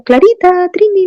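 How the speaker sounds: high call of two names; calling the dogs. Retold or imitated. Landlady calls the puppies by name, high call. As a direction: call the puppies over the balcony.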